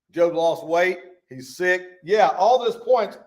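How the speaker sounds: ordinary talk, nothing marked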